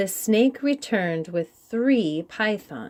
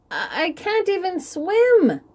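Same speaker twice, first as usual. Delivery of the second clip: calling after; 10 semitones higher than usual. Acted. Koi calling after the leaving chief in despair. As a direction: call out after him, panicked. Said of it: "Koi called after him".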